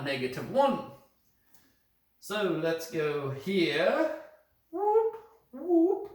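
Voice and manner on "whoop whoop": high whooping call, playful; a silly celebratory whoop; mock whoop. Teacher whoops at the class, high and playful. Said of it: "I don't know how to do a whoop in a British accent".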